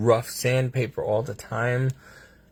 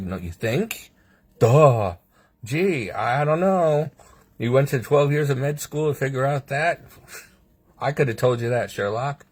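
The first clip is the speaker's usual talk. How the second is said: sarcastic retort; 4 semitones higher than usual. Retold or imitated. Patient mocking doctors' obvious diagnosis, sarcastic. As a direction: rub in how obvious it is.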